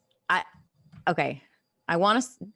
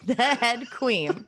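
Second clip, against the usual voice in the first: mock royal; 4 semitones higher, much slower than usual. Finishing the royal hail.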